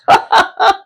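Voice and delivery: a high burst of real laughter; high giggling burst. Real, not acted.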